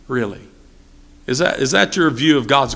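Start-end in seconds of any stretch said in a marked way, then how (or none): 0.1-0.4 Really? dropped low and soft; hushed disbelief on Really?; muttered disbelief; quiet, low Really?. Acted. Preacher challenging the excuse.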